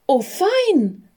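put-on a girl's high, delighted cry; a light, high little girl calling out; excited girl. A narrator's reading. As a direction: squeal with joy at the find. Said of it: "rief ein Mädchen".

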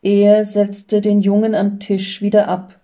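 ordinary delivery, nothing marked

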